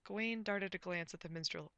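usual speaking voice

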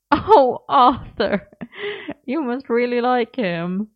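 can't stop laughing at him; snorting laugh. Acted. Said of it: "a strangled sound that was clearly a snort".

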